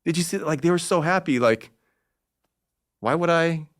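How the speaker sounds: put-on a touch of a gentle old man in awe; Stan Lee voice. Old man asking a young fan about happy faces, gently amazed. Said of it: "And he's like".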